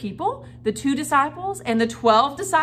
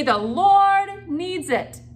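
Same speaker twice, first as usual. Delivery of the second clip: higher, louder, very slow; loud call-and-response; 6 semitones higher, 5 dB louder, much slower than usual. Shown as a demonstration. Teacher leading the kids in saying it, loud and slow. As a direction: lead the kids through it word by word.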